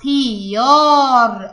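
long vowel drawn out slowly in خيار; stretched reading. Shown as a demonstration. Pupil reading aloud for teacher, long vowel stretched. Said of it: "اقريها الكلمة معايا".

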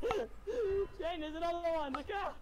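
high, loud frantic calling; urgent warning shouts. Real, not acted. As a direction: yell to warn him. Warning friend of cars.

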